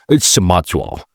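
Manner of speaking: usual speaking voice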